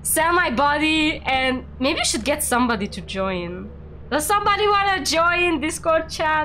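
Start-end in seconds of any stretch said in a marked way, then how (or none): 4.1-6.4 raised-pitch invitation; calling out. Real, not acted. Streamer asks chat to join Discord, calling out. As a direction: call out an open invite, voice lifted.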